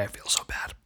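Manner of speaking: hushed whisper. Real, not acted. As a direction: whisper it guiltily. Whispered aside.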